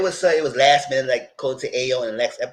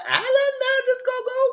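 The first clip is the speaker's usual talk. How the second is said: much higher sing-song voice; high sing-song doubt. Retold or imitated. Telling the hosts the icebreaker is risky, in a high sing-song voice.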